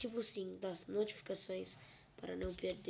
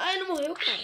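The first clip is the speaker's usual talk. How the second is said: much higher, louder shocked yelp; crying out in disbelief; 10 semitones higher, 13 dB louder than usual. Real, not acted. Mob survives. (grito agudo de espanto)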